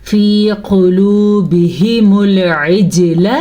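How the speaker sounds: slow melodic tajweed recitation; Quran recitation. Shown as a demonstration. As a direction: draw the vowels out like a Quran reciter. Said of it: "teacher recites the verse to teach".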